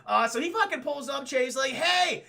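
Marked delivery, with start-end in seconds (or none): 1.7-2.2 high, loud holler; mock calling out. Police officer pulling up below her, mock holler. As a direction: imitate shouting up to someone.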